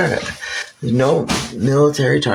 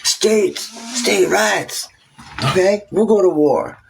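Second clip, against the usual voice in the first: mock exasperated settlers; 6 semitones higher than usual. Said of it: "they're like".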